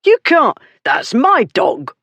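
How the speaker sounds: high, loud outraged yelp; a man losing his dog, shrill with outrage; outraged cry. Acted. Dog owner crying out as the lady takes his dog, high and loud.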